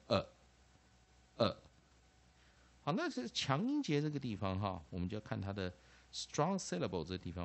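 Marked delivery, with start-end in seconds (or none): 0.1-1.5 short isolated '呃', repeated after a pause; weak vowel. Shown as a demonstration. Teacher modelling weak A for students, short '呃' repeated. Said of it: "A的弱母音就念呃".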